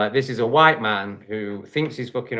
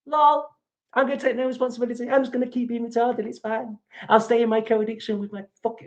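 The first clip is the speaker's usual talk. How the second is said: fast, high sneering mock voice; mocking the commenter; 12 semitones higher than usual. Streamer imitates a commenter dodging responsibility, high fast mockery. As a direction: play him as a giggling fool. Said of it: "rather than going".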